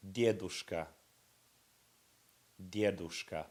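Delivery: teacher models dedushka for students to repeat; model pronunciation. Shown as a demonstration. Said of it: "one line down".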